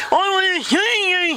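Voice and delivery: very high, garbled demand; upset Vicky. Acted. Vicky demands to see the boss, high garbled upset. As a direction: insist in a mangled put-on voice.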